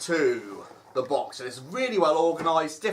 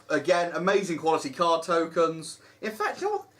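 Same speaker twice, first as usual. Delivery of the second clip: ordinary delivery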